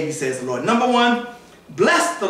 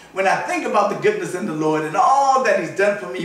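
ordinary talk, nothing marked

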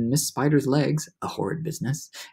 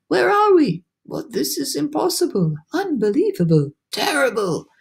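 storybook panic in raised voices; alarmed disbelief; 10 semitones higher than usual. A narrator's reading. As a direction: exclaim in astonished dismay. Creatures discover they are at sea.

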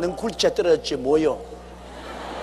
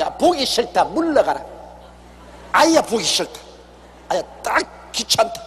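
a displeased God waving someone off, raised and impatient; God fed up; 4 semitones higher than usual. Acted. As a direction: push the sinner away in disgust, slowing at the end. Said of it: "그렇게 말할 것입니다". (짜증난 하나님 목소리)

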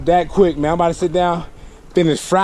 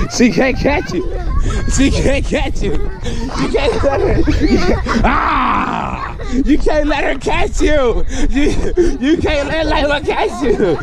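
laughing cheer; 7 semitones higher than usual. Real, not acted. Cheering kids in tag, laughing.

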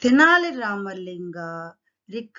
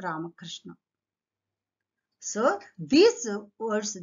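ordinary talk, nothing marked

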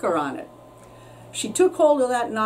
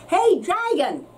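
high shout; 8 semitones higher, slower than usual. A narrator's reading. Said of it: "She shouted as loud as she could".

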